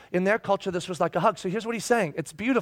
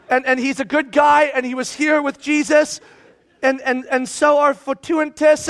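raised, high protective plea; loud pleading; 6 semitones higher, 7 dB louder than usual. Acted. Letter reader to glaring church, loud pleading. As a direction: plead loudly on his behalf.

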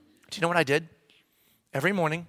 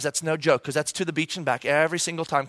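ordinary delivery

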